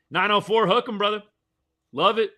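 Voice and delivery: loud, high hook 'em cheer; excited cheer. Real, not acted. Fan hyping his team on the stream, loud cheer. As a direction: fire up the fans.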